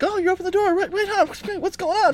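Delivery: very high, frantic cartoon dog; scatterbrained Brittany voice. Owner voicing his Brittany at the truck door, very high and frantic. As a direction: squeal it like an overexcited pup. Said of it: "the Brittany's like".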